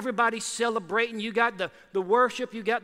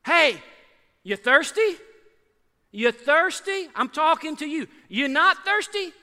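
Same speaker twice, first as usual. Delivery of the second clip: calling out; 5 semitones higher, 6 dB louder than usual. Acted. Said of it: "And that's what he says".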